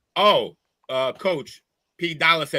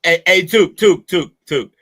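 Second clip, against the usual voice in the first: loud, raised, repeated call; 4 semitones higher, 10 dB louder than usual. Real, not acted. Host interrupting to get Took's attention, calling out.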